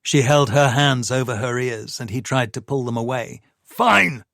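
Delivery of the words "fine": higher, louder burst of anger; shouting. A narrator's reading. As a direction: snap it out loud. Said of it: "he shouted".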